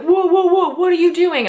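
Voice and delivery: high, loud, startled whoa; hollering to stop him licking salt; alarmed cops. Retold or imitated. Cops yelling at Mulder licking the salt, high and loud. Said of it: "all of the cops are like".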